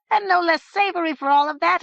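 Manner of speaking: high, loud gloating head; high head voice, gloating menace; gloating declaration. Acted. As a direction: relish the threat. Head gloats over cooking guests.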